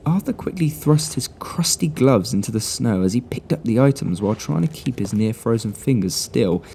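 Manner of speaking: plain reading voice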